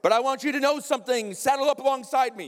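loud, high-pitched college president impersonation; Doctor Haug. Retold or imitated. College president draws his students in, loud and emphatic imitation. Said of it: "he would say that".

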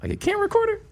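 very high, puzzled comic guess; mock bafflement. Retelling his guess to the cop about Cam, high mock bafflement. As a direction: ask it in a squeaky, clueless pitch.

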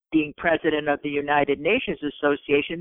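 usual speaking voice

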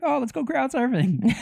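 bursting with eagerness; excited kid. Retold or imitated.

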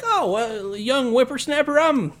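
put-on high, slow creaky old-timer voice; old prospector. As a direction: play a rickety old man. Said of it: "and he'd be like".

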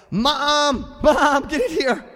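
calling out for mom. Retold or imitated. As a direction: call mom into the room, high and loud. Daughter calls mom for help.

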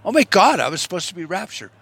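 high, panicked cry of alarm that rushes on; a sudden jolt of religious panic; spiralling mock panic; freaking out. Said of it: "but you freak out".

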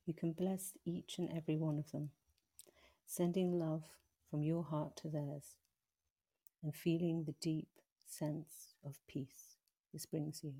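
slow, gentle meditation voice with long pauses; guided meditation. Acted. Guiding listeners through a forgiveness meditation, slow and gentle. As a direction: ease the listener through the breath hold softly.

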